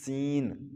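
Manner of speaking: سین with a clear s-whistle at the start; sharp sibilant start on سین; whistled seen. Shown as a demonstration. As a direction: hiss the s of سین first. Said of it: "سیٹی کے ساتھ ہم پڑھیں گے".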